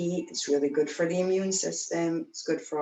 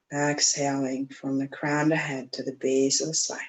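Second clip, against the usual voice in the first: guided meditation. Acted. Guides breath up the spine.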